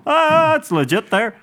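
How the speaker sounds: high, slow put-on approving voice; hammed-up convinced listener; mock impressed. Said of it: "people tend to go".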